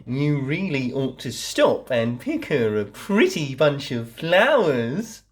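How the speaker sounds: lightly voiced sly wolf; wolf's coaxing. A narrator's reading. Wolf tempting the girl off the path, lightly coaxing. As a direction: coax her like a crafty wolf. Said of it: "said the wolf".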